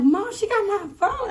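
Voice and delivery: high, loud, drawn-out retold whine; reenacted upset tattling, slow and high; angry crying complaint. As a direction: wail it to mom, acted out. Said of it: "I'm angry. And I'm like".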